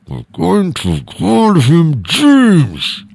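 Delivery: shrill mocking spouse dragging out James; hammed-up partner overruling the name. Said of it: "end in a divorce".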